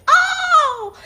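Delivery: high, loud "oh" screamed out; boy's pained scream. A narrator's reading. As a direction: scream out in pain across a mountain. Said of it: "hurts himself and screams".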